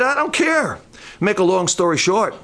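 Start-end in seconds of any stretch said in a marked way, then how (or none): 0.0-0.8 defiant Samson. Retold or imitated. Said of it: "Samson said".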